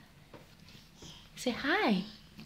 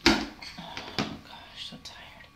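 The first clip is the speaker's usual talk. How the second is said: pretend tired whisper; 6 dB quieter, much slower than usual. Mother voices duck, whispered.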